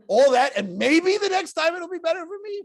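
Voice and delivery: high, loud disbelief; incredulous outburst. Retold or imitated. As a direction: throw the question back in shock. Elazar's incredulous retort.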